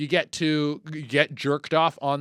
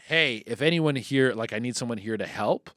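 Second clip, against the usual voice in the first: normal talking voice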